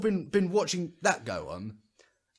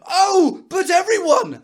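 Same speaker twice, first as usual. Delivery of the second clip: much higher and louder, whiny and indignant; mock outrage; 13 semitones higher, 9 dB louder, slower than usual. Mocking the everyone-matters crowd on Facebook, loud high squeal. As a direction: squeal it like a wounded online crusader. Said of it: "people going".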